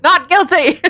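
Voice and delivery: high, playful proclamation; joking mock verdict. Co-host jokes about making a case for him, mock verdict. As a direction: call out a jokey verdict, laughing.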